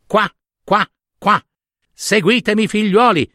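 storybook mother duck summoning her little ones; mother duck calling. Acted. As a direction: call the ducklings along, quacking in a high voice. Mother duck calls ducklings to follow.

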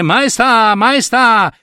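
high-pitched urgent call; insistent high cries of a title; calling after someone. Acted. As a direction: call out to make her turn round. Voices calling behind the queen.

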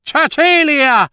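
high, drawn-out call to a daughter. Acted. Mother summoning her daughter Cecilia, calling out high. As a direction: summon her from another room.

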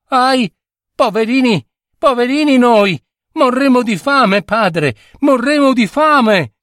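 high, anguished lament; despairing wail. A narrator's reading. Starving girl wailing to her father, in despair.

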